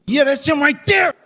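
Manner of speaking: high, shouted pointing-out; accusing shout. Retold or imitated. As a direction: nephew shouting to point him out. Nephew identifies him.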